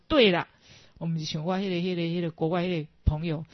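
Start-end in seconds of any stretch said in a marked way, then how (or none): 0.1-0.5 大声肯定"对了"; loud approving. Retold or imitated. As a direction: boom out "对了" in praise. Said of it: "我说". (老师满意地大声说对了)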